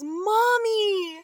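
mock child's cry. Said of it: "and he says".

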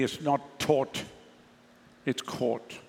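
South African rounded "aw" in "taught" and "caught", drawn out; South African accent. Shown as a demonstration. Preacher corrects the congregation's pronunciation, South African accent. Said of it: "proper South African accent".

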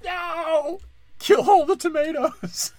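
high, loud cartoon shout; cartoon cucumber yell. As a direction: scream it like a furious cartoon vegetable. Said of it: "The cucumber just like".

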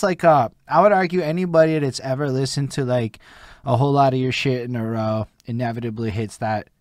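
ordinary delivery

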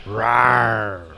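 mock reindeer roar; playful monster growl. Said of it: "that is exactly the noise reindeer makes".

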